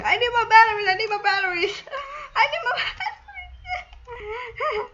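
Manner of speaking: high, loud replay of her own frantic yell, dissolving into laughter; mock insane screaming. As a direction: ham up the panic like a crazy person while cracking up. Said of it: "like an insane person screaming".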